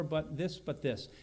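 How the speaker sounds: offhand, unstressed "but this"; casual "but". Shown as a demonstration. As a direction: toss off "but this" quietly and low. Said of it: "It's kind of a casual".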